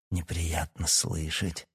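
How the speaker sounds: slow, soft, low repetition; cold echo. A narrator's reading. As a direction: repeat it slowly, hushed and cool. Father coolly echoes son's words.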